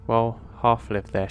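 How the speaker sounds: ordinary delivery, nothing marked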